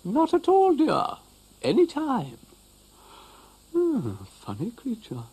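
motherly cow soothing. Acted. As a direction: cow calms the upset dog, dear. Cow replying.

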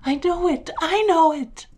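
high, loud cry of anguish; a father's anguished outburst; agonized cry. A narrator's reading. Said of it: "cried my father in agony".

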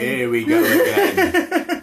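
high, laughing here we go again; echoing mum's remark, high and laughing. Real, not acted. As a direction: toss it back through a giggle. Echoing mother's line laughingly.

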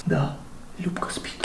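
hushed whisper. Real, not acted. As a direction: whisper so Lyubka stays asleep. Hiding from sleeping Lyubka.

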